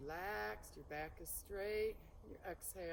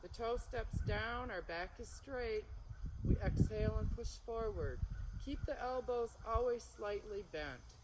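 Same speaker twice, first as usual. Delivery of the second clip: usual speaking voice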